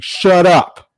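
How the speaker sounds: hammed-up star actor telling off the producer; mock diva outburst. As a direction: play the offended star snapping back. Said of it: "It's like".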